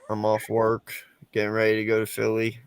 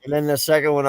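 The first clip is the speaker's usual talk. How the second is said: usual speaking voice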